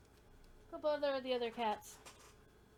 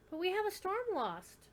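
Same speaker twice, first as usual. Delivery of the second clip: excited; 9 semitones higher, 5 dB louder than usual. Real, not acted. Excited at the kitten appearing.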